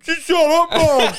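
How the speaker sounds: put-on high, sulky kid snapping at mum; whiny little sook. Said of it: "Like a little sook".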